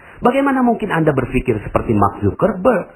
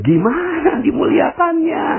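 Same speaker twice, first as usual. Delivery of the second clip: incredulous shout. Retold or imitated. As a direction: shout it in disbelief. Jamaah bertanya heran. (teriak tak percaya)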